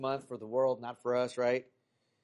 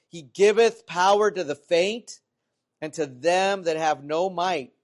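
loud, slow, raised scripture reading; 3 semitones higher, 7 dB louder, slower than usual. Acted. Preacher reads scripture to congregation, loud scripture reading. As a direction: proclaim the verse with drawn-out key words.

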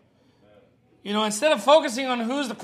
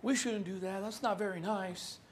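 soft, prissy mock objection; mock voice of a disapproving critic; 10 dB quieter than usual. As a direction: parody the politically correct crowd. Mocking imagined critics.

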